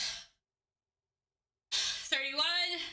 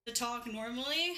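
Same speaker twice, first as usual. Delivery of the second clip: ordinary talk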